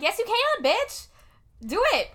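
loud, high, playful egging on; mock cheer. Urging her mom to wear leggings, a loud high mock cheer. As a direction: hype her up, teasing. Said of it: "And I'm like".

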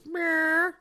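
high mock meow; imitating cat meow. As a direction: copy the cat's meow. Copying the cat noise.

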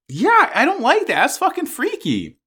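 high-pitched uneasy protest; creeped out. Real, not acted. Reacting to a disturbing story idea.

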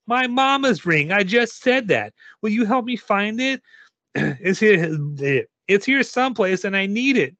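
put-on high, needy child's voice; high-pitched, exasperated girl; insistent little girl. A narrator's reading. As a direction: plead impatiently like a small kid.

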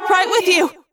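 high, loud answering shout; yelling back. A narrator's reading. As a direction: shout back over the chaos. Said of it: "I yelled back".

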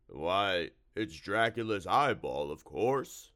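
slow, loud creature voice; drawn-out monster answer; slimy monster, obliging. A narrator's reading. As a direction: explain it slowly as the monster.

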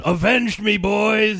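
loud, strained dying cry; overacted death scene. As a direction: shout it as your last words. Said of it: "the original Red Dawn".

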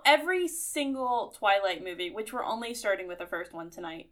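usual speaking voice

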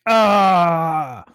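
loud, high drawn-out "aah"; pained cry. Acted. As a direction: cry out as if just blinded, play-acted. Monster reacts to being blinded.

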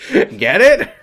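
high, hammed-up joke prompt; mock nudging. Reader fishing for a laugh after dad joke, mock nudging. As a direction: pitch it up and prod for a laugh.

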